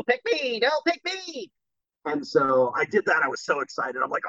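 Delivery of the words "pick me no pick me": high, begging cartoon voices; tiny sandwiches vying to be picked; pleading sandwiches. Retelling the ad, sandwiches begging high.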